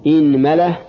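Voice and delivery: "inmala", opening on i with a after the m; kasra, then fatha. Shown as a demonstration. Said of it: "كسر الهمزة". (إنملة بكسر الهمزة وفتح الميم)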